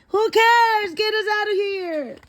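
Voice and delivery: put-on a scared kid begging to leave; terrified child's plea; panicked whine. Kid panics while teacher lectures.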